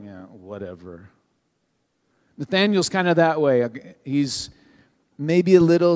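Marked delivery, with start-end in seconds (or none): 0.0-1.1 much lower and softer, dismissive; bored brush-off. Retold or imitated. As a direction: shrug it off, totally uninterested. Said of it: "the other person's like".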